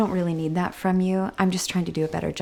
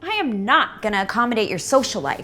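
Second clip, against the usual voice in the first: angry; 5 semitones higher, 4 dB louder than usual. Acted. Mother scolding teenage daughter.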